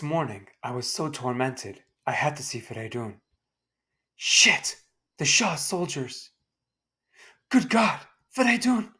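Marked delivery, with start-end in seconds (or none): 4.2-8.9 shocked, high cries of alarm; startled outburst; alarmed cry. Acted. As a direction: panic at the soldiers. Witnessing friend's arrest.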